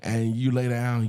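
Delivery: ordinary talk, nothing marked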